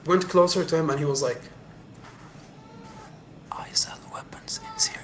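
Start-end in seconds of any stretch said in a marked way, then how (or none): 3.5-5.0 hushed unvoiced whisper. Arms dealer whispering to the narrator, hushed whisper. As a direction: lean in and whisper the secret. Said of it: "You need to speak louder".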